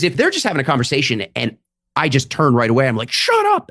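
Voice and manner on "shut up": a sudden much higher yelled shut up; mock scream. Comic imagines yelling at a talking couple, mock scream. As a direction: snap it at them like losing your cool. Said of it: "I just start screaming at them".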